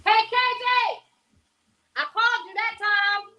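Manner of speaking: playful imagined holler to KJ; calling out to KJ. Retold or imitated. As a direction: sing out his name to get his attention. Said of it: "I probably did call K J in my mind".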